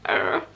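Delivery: a deep, low "ugh" groan. Retold or imitated. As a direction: grunt as the bed hits the wall. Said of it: "and I was like".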